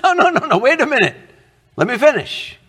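high-pitched, insistent protest; protesting interjection. Acted. Preacher to objector crying legalism, high insistent protest.

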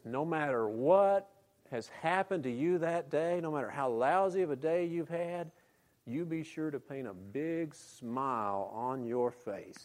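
slow Alabama drawl; a light Alabama preacher accent. Retold or imitated. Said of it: "falling into his Alabama accent".

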